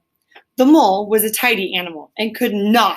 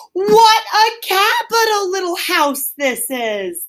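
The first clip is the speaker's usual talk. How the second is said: high, slow, delighted exclamation; cheery call; 11 semitones higher, slower than usual. A narrator's reading. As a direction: sing it out, thrilled by the little house. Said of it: "He called out cheerily".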